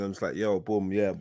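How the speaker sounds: ordinary talk, nothing marked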